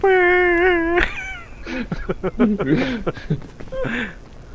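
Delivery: loud laugh. Real, not acted. Laughing at struggling friend.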